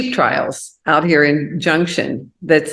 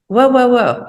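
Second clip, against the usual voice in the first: higher and louder stop; startled protest; 8 semitones higher, 4 dB louder than usual. Retold or imitated. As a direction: halt him in surprise. Husband brings home a puppy.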